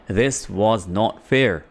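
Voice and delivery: "was not" said as two slow, separate words; slow, full form. Shown as a demonstration. Teacher drilling the full form for students, slow and deliberate. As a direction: spell out every word, slowly, for learners. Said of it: "अब was not को हम fluently बोलने के लिए".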